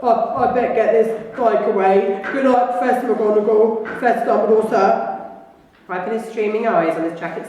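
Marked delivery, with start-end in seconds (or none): 0.0-5.1 louder, choked-up farewell; tearful Hagrid. Acted. Hagrid tearfully saying goodbye to the professors. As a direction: say goodnight while fighting tears. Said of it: "in a very muffled voice".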